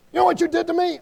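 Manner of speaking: high, loud aggrieved outburst; mock indignation. Offended person confronting whoever wronged them, mock indignation. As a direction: act out a wounded accuser confronting someone. Acts out someone defending themselves.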